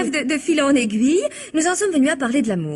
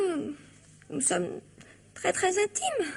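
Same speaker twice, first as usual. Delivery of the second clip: embarrassed confession; 10 dB quieter, slower than usual. Acted. Wife confessing an affair to husband.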